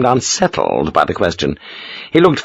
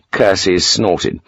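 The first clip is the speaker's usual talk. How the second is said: plain reading voice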